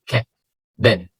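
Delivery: كات and باب with a quick open short a. Shown as a demonstration. Teacher demonstrating short open a on كات and باب. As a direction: snap the mouth open fast on كات, باب. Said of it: "افتح بقك يا صاحبي بس بسرعة كده".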